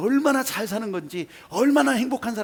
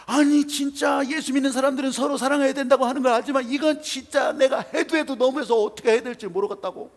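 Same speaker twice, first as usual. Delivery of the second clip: raised, agitated voice of a wronged man; higher and louder, venting to the pastor; angry complaint; 7 semitones higher, 5 dB louder than usual. Retold or imitated. Angry neighbor retells his grievance. (목사님께 억울함을 호소하는 흥분한 목소리)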